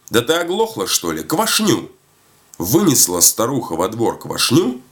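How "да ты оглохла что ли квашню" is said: high, impatient correction; exasperated shout. A narrator's reading. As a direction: pitch it up at someone who won't hear. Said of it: "кричит". (раздражённо, высоким голосом)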